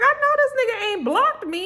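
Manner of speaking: high put-on voice of indignant disbelief. Sheree hearing she may be blocked, high indignant disbelief. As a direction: play her refusing to believe it. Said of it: "And Sheree was like".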